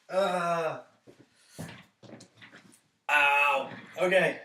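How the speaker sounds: pained ow through stinging eyes; eyes watering. Real, not acted. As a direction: wince through the burn. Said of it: "my eyes are watering like crazy".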